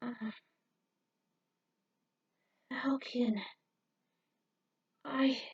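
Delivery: soft, unvoiced, halting whisper; barely able to speak after the crash; weak whisper. Rick waking up injured, telling Falcon he failed, weak whisper.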